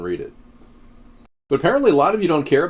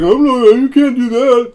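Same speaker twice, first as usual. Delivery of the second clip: son's voice; 11 semitones higher, 8 dB louder than usual. Retold or imitated. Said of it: "he's like".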